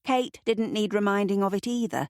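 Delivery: usual speaking voice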